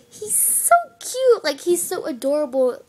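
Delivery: squeaky cooing; gushing. Real, not acted. Gushing over adorable friend.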